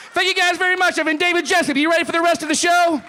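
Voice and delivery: booming crowd-hype sign-off; loud hype sign-off. Real, not acted. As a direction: pump up the crowd like a host. Loud sign-off, show host hype.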